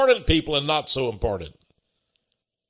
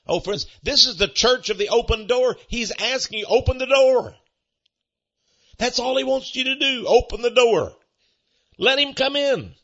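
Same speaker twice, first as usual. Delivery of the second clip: fervent preaching; 8 semitones higher than usual. Acted. Preacher urging congregation, high fervent appeal. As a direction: urge them to open the door.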